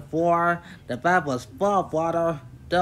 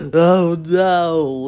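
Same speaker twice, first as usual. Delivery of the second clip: loud, higher groan of dismay; 4 semitones higher, 10 dB louder than usual. A narrator's reading. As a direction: groan it out in dread. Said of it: "he groaned".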